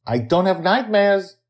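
put-on high, impatient child's protest; impatient girl. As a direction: correct grandma with a child's impatience. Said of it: "Joy reminds Susie impatiently".

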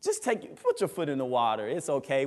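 high, coaxing voice of anxiety; preacher voicing anxiety as a high, coaxing tempter. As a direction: sweet-talk like a tempter whispering in the ear. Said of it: "Anxiety will play tricks on us and tell us".

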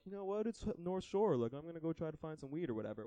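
a hint of a naive holidaying dad; dad on vacation. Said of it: "some dad on vacation that was like".

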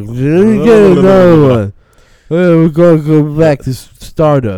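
put-on loud, rowdy drunk bar patron; drunk guy voice. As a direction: belt it like a tipsy regular.

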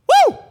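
high, loud "woo"; real burst of pumped-up energy; amped-up whoop. Real, not acted. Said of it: "I am amped up".

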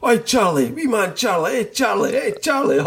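high, rising playful Scottish calls; excitable high-pitched Scots greeting; Scottish hailing of Charlie. Retold or imitated. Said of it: "talking in your Scottish accent to Charlie".

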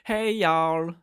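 loud, high try at Southern; learner's imitation of a Southern greeting; attempted Southern accent. Shown as a demonstration. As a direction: have a go at a Southern holler. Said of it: "I'm trying".

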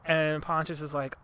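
ordinary delivery

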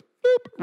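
high, short "boop"; sudden high-pitched boop; playful mimicked reset-button noise; imitated button beep. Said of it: "She just goes".

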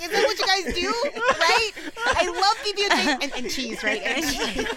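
high loud mocking voice; hammed-up wannabe, laughing; mock eager friend. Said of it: "He's like".

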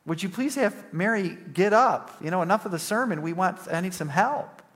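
high-pitched, pleading complaint; exasperated challenge. Retold or imitated. Martha to Jesus about her sister not helping, exasperated challenge. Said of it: "And Martha challenges Jesus".

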